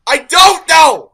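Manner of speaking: slow, loud exasperated yell; frustrated shout. Real, not acted. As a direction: drag it out and shout it. Exasperated outburst in argument.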